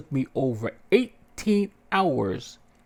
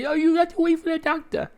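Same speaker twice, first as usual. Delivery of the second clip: much higher mock nurse voice; dismissive nurse; 3 dB louder than usual. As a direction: brush the patient off. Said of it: "He's like".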